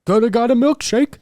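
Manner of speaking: high, loud mimicry; mocking echo. Friend teasing him over a missed free milkshake, mocking. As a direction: parrot it back in an exaggerated high voice.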